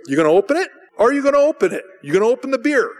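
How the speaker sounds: loud, high, insistent questions; mock badgering. Brothers pressing a guest to open beer, badgering. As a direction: needle him, each question pushier.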